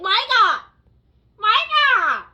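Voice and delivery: high, full-volume call for Michael; loud, high-pitched calling out; screamed name. Shown as a demonstration. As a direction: scream for someone lost in a store. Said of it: "screaming some random guy's name".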